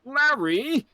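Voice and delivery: high rising call of Larry!; Edgeworth calling Larry. Retold or imitated. Friend imitating Edgeworth's call, high rising. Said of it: "Edgeworth would sound like".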